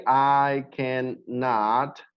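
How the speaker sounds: "I cannot" stretched very slowly; slow formal model. Shown as a demonstration. Teacher modelling the formal form, very slow. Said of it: "You can also say".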